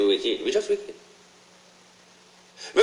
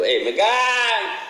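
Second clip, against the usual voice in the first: mock prayer; 11 semitones higher, slower than usual. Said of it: "pray your blood clot self".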